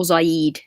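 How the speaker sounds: "Zaid" with a long ee in the last syllable; "Zaid" offered slowly as a second guess; alternative pronunciation. Shown as a demonstration. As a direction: try "Zaid" as za-EED, the other option. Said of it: "Is it Zayad".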